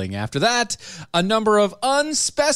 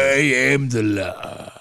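slow, grand decree; pompous tyrant voice; 2 semitones lower, slower than usual. Retold or imitated. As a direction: declare it like a self-made dictator. Quoting Whitmer as tyrant.

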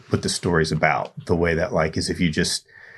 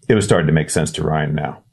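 ordinary delivery

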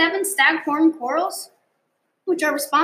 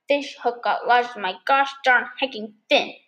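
ordinary talk, nothing marked

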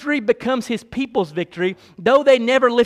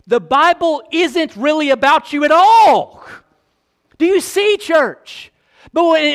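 higher, louder pulpit fervor; full-force preaching; 6 semitones higher, 4 dB louder than usual. Acted. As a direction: build and hammer each repeat.

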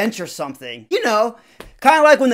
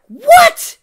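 squeaky, much higher, loud startled yelp; shocked what; 13 dB louder than usual. Retold or imitated. Student hearing he must talk to the girl, high shocked yelp. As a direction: jump out of your skin on it.

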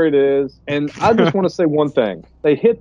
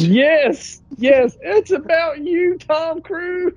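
high, drawn-out tearful whine; hammed-up widow consoling through fake sobs; mock weeping wail; 12 semitones higher, much slower than usual. Joins the parody of weeping wife.

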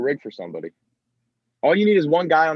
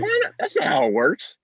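much higher mock indignant squeak; mock whiny protest. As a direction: squeak it like a teen protesting mom. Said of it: "I was like".

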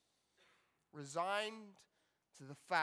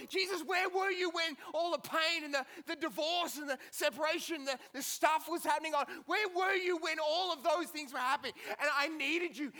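anguished cry; 8 semitones higher, 3 dB louder than usual. Acted. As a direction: keep crying out, the grief still raw. Martha crying out to Jesus.

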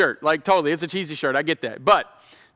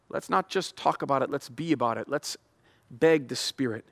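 usual speaking voice

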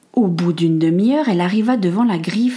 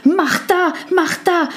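high urgent call across the house; Henry calling Martha in alarm; 11 semitones higher, slower than usual. Acted. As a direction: shout her name in alarm. Henry calling his wife urgently.

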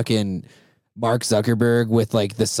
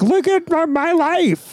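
mocking aunt voice; slower than usual. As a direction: gush like an oversharing aunt. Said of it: "They're all like".

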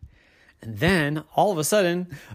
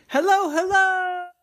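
calling out; 3 dB louder than usual. Acted. Kids entering an open house, calling out high. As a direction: sing out a hello to see who answers. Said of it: "and they said".

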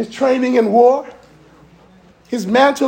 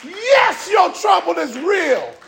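full-throated preacher's call; preaching at full force; 5 semitones higher, slower than usual. Acted. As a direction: preach it out to the crowd.

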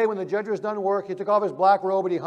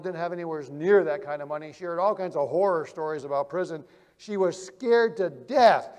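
ordinary delivery

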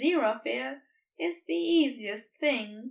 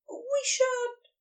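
higher angel voice, hesitant; Aziraphale's voice; 13 semitones higher than usual. Acted. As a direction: lift into the angel's voice, unsure. Aziraphale voiced, answering Crowley.

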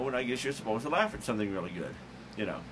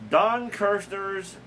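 drawn-out announcer; 8 semitones higher, much slower than usual. Pretend.

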